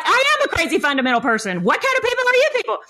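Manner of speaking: high-pitched incredulous exclamation with a laugh; indignant retort. Retold or imitated. Speaker retelling her comeback to the study group, indignant. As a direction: throw it back at them, pitch soaring.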